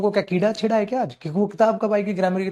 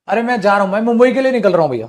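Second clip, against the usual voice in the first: louder, fast casual announcement; louder quick casual goodbye; casual example speaker; 4 semitones higher, 6 dB louder, faster than usual. Retold or imitated. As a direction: toss it off like leaving home. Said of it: "जैसे".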